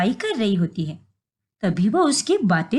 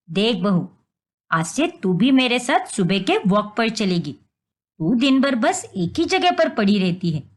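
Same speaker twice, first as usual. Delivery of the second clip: stern mother-in-law; 4 semitones lower than usual. Acted. Mother-in-law lectures daughter-in-law.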